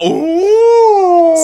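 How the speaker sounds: loud, very high hammed-up "ooh"; long scandalized group gasp, imitated; mock crowd ooh. Whole cast reacts to a catty jab, mock crowd ooh.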